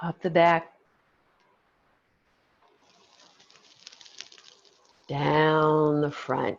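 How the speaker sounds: slow, calm breathing cue; guided meditation. Acted. Leads breathing exercise.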